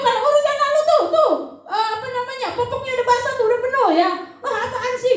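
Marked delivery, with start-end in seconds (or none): 4.4-5.1 annoyed parent. Retold or imitated. Parent annoyed at dirty diaper.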